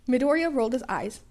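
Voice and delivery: usual speaking voice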